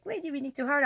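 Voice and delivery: put-on high, babyish whine; mock a whimpering baby; a childish mock whine; whiny baby voice. Shown as a demonstration. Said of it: "I didn't say it like a baby".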